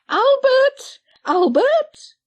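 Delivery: high, loud call across the yard. Acted. Patty calls Albert from outside the door, a high, loud call.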